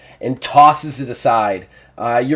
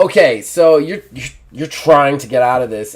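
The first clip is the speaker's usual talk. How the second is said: normal talking voice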